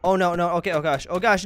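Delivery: ordinary delivery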